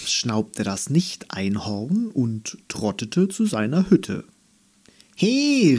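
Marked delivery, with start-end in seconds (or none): 5.1-5.8 high call after him. Acted. Andi calls after the grumpy Nicht-Einhorn. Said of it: "rief sie ihm hinterher".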